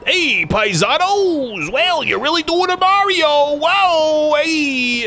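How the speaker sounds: high, loud, hammed-up Mario impression; Albano Mario voice. Imagined sex worker plays Albano Mario, high and hammed-up. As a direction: bounce through it like a cartoon plumber. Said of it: "I'd really like an Albano".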